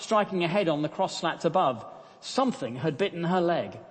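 reading a story aloud to the congregation. Acted. Preacher reading the camp story aloud. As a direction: paint the scene as you read.

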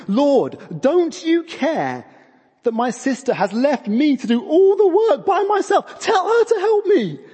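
high, loud, hammed-up complaint climbing to a squeal; building frustration. Martha complaining to Jesus about her sister, frustration boiling over. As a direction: let exasperation boil over into a mock tantrum. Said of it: "you kind of feel her frustration building up".

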